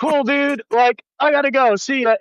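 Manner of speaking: put-on to the drunk dancer cursing him, a breezy high brush-off.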